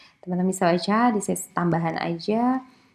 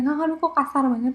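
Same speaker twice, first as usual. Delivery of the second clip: a lightly higher, mock-offended "aduh"; shocked Jogja listener; 7 semitones higher than usual. Lecturer imitates a Jogja person shocked at rude words, mildly scandalised. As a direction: play a polite Jogja listener put off by rudeness. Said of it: "orang Jogja mungkin".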